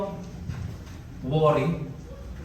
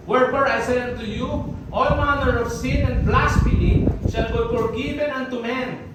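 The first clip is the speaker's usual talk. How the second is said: scripture proclaimed; 3 semitones higher than usual. Acted. Reads scripture aloud.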